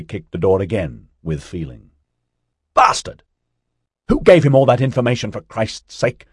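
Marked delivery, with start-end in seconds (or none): 2.7-6.3 loud, quick, higher-pitched fury; furious outburst. A narrator's reading. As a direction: explode with the curse, then demand an answer. Kicks door, rages about the leak.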